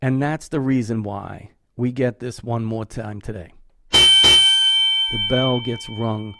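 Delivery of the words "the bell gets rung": long ringing bell tone over speech. Real, not acted. Ringing a bell for his late father, slow and ringing. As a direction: let the bell ring out slowly.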